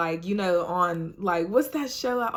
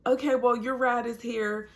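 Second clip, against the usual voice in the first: nurse voice; 6 semitones higher than usual. Retold or imitated. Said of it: "everybody down there spoke Spanish".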